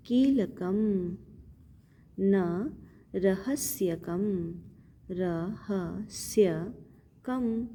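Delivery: रहस्यकम stretched, ending on म; very slow recitation. Shown as a demonstration. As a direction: draw out रहस्यकम. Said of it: "इसको म पढ़ेंगे". (बहुत धीमा पाठ)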